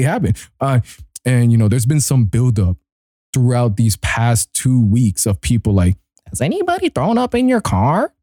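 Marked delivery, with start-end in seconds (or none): 6.3-8.1 high-pitched mimicking voice; hammed-up high, curious question; nosy questioners. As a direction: imitate eager askers way up high. Mimics people asking him the question.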